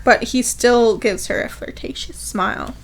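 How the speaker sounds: ordinary delivery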